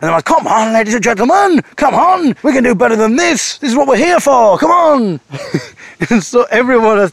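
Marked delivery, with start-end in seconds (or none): 0.2-5.2 high, loud pushy compere; hammed-up compere begging for applause; MC urging applause. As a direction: whip the crowd into clapping.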